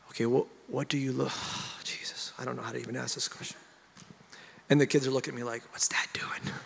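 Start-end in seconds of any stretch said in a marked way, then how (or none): 0.1-3.6 soft, faltering, with a heavy sigh; stumped prayer. Retold or imitated. Dad asking God in front of the kids, stumped and hushed. 5.7-6.5 hushed mock whisper of baffled children; kids' puzzled whisper. Kids watching their dad act strangely, puzzled whisper.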